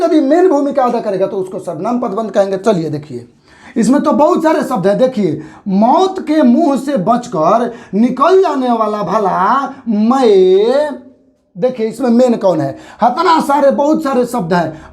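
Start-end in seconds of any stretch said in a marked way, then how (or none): 5.0-11.0 slow reading with a long stretched मैं; stress on मैं. Shown as a demonstration. Teacher reads the example to the class, stretching मैं. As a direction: draw out the phrase and lean on मैं. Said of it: "बोल कर देखिए".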